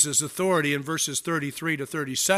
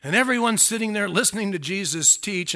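ordinary delivery

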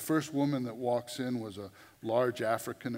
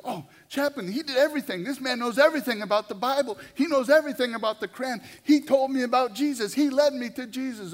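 much higher, much louder, fast gushing praise; gushing excitement; 12 semitones higher, 10 dB louder, faster than usual. Retold or imitated. New convert telling the chaplain about the preacher, high excited gushing. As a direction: rave about him breathlessly.

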